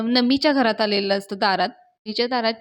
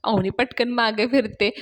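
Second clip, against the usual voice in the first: usual speaking voice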